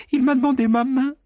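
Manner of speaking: mocking boast at the pig; shrill boast. A narrator's reading. Repeats her boast, higher.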